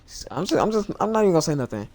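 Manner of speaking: fast, loud and high; exasperated outburst. Real, not acted. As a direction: rush it out, biting your tongue. Holding back a reaction.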